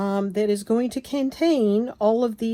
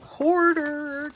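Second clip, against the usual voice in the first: mock self-accusation; 7 semitones higher than usual. Real, not acted. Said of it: "Can you say hoarder?".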